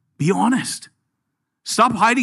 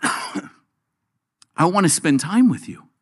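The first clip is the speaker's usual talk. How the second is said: tender invitation; 2 semitones lower, much slower than usual. Acted. Preacher voicing Jesus' tender invitation.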